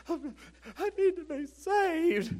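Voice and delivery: high, slow, tearful wail of a woman; imitating a weeping woman at the altar; crying plea. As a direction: stretch it out like she's sobbing. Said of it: "she was crying like crazy".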